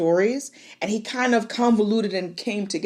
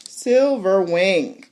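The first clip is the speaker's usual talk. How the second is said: drawn-out reveal; much slower than usual. Shown as a demonstration. Building up the dragon's entrance, slow dramatic Silverwing. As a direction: savor the name Silverwing slowly. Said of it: "dragon name drawn out".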